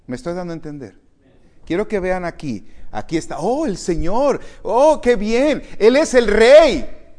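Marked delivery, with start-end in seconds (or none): 3.4-7.2 loud, slow, high exultant cry; joyful praise. Pretend. Preacher voicing worshippers praising the King, exultant. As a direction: lift it up like happy worshippers.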